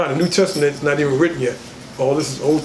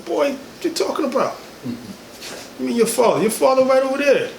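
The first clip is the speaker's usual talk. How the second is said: much higher voice of an exasperated mother; high mock mother scolding; 9 semitones higher than usual. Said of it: "they didn't understand".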